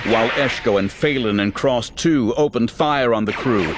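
ordinary delivery

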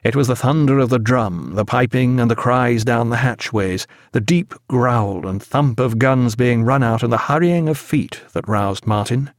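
usual speaking voice

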